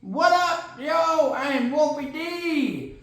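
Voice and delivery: high, drawn-out character intro; Wolfie D. Acted. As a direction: introduce yourself as a high-voiced cartoonish co-host. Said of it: "my co-host today is Wolfie D".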